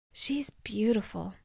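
soft, slow awed whisper. Acted. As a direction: breathe it out in wonder. Quiet awe on eagle.

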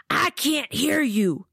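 high, slow I can't hear you; slow demanding drill instructor. A narrator's reading. Laban demands louder.

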